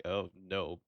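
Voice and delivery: normal talking voice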